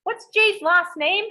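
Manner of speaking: high, slow mock-suspicious read; mocking the client's text. Agent mocks the client's text about Jay, high and slow. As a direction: drag out the client's nosy question in a mock voice. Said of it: "the next text is".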